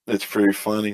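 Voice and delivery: ordinary talk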